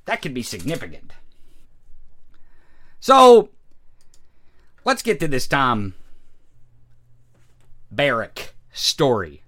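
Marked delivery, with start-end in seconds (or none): none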